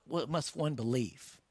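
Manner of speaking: usual speaking voice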